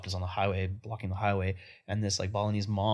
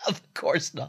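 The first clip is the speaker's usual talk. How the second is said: laughing mock denial; 6 semitones higher than usual. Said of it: "mocking friend's flat denial".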